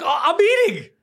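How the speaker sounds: high, loud mock reply; mock innocent. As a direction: play it dumb and squeaky.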